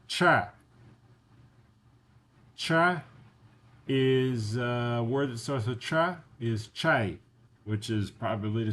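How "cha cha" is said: "cha" called out higher and louder; Russian letter demo. Shown as a demonstration. Teacher models the Russian letter for learners. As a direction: sound out "cha" clearly. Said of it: "the next is cha".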